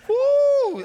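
a sudden high, loud whoop; excited whoop. Retold or imitated. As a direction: whoop like the camp chaos is hitting you. Recalling rowdy junior high camp.

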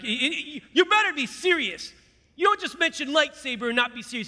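loud, high-pitched excited insistence; hammed-up demand, stammering into it; mock-stern warning. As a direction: act wildly serious about something silly. Acted warning to recruiter about lightsaber.

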